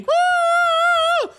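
put-on high, loud kid's whoop; kids cheering woo. Kids in the crowd cheering, a loud high whoop. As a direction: whoop like an excited child at a festival. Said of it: "kids going".

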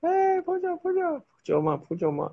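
high sing-song call to a friend. Real, not acted. Calling his best friend Phoe Kyaw in a high voice. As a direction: call his name up high across the distance.

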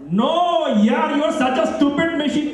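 high-pitched exasperated outburst. Acted. Caller insults a phone machine that misheard, exasperated. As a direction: pitch jumps up in frustration.

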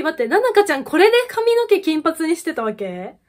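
can't believe it, voice jumps up; excited disbelief. Real, not acted. Fan spots idol's blonde hair, excited disbelief.

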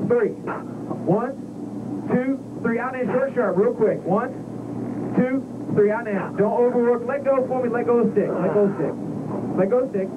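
high, driving callouts, breathe counts; urgent breathing coach. Real, not acted. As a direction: push the pilot through every breath. Instructor calls breathing during the G run.

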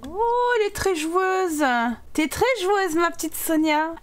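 high, drawn-out cooing; singsong high voice for a little one; playful cooing. Pretend. Cooing at her playful Sim.

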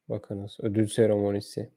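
ordinary delivery

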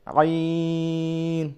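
আইন drawn very long from mid-throat; throat letter. Shown as a demonstration. Teacher demonstrating আইন from the throat. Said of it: "হলকের মধ্যে আইন".

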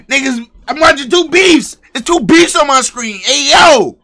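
high, loud, exasperated shouting; overwhelmed yelling. Real, not acted. Streamer to his viewers about two beefs at once, overwhelmed yelling. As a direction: yell out in disbelief at the screen.

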